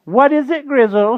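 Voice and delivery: loud, high witch voice; witch's cry. A narrator's reading. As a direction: call out sharply as a witch. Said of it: "she cried".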